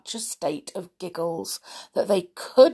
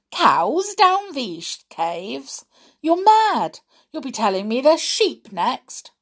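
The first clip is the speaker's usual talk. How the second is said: scornful disbelief; 6 semitones higher, 3 dB louder than usual. A narrator's reading. Missus Stick scoffs at her husband.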